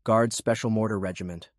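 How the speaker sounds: usual speaking voice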